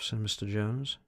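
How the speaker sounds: usual speaking voice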